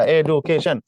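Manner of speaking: এডুকেশন drawn out in slow chunks; syllable by syllable. Shown as a demonstration. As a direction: count the syllables of এডুকেশন aloud, slowly. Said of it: "তিনটা".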